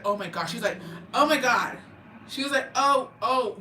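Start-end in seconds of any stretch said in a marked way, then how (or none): 1.1-1.8 louder, higher shocked outburst. Retold or imitated. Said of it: "she was shooketh". 2.8-3.6 imitated stunned reaction, higher and louder; shaken. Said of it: "she was shooketh".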